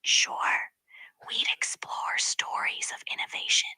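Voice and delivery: soft unvoiced whisper. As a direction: whisper it like ASMR. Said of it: "Can you whisper the rest of it".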